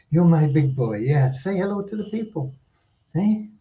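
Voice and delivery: high sing-song pet talk; talking to a dog. Real, not acted. Calling and praising a dog.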